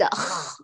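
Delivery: أخ low with a snoring rattle; rattling kha. Shown as a demonstration. Teacher imitating the overdone snoring kha, low and soft. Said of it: "صوت الشخير المتكرر".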